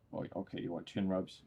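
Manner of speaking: ordinary delivery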